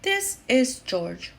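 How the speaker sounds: ordinary delivery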